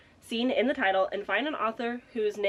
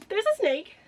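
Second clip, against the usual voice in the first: excited; 10 semitones higher, 4 dB louder than usual. Real, not acted. Excited at matching snake.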